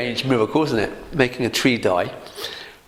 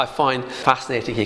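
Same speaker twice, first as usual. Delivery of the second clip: ordinary talk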